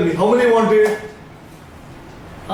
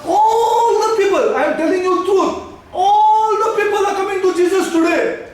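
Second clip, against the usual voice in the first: impassioned preaching; 9 semitones higher than usual. Real, not acted. Preacher telling the church the truth about seekers, high impassioned cry. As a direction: proclaim it high, stretching the first words.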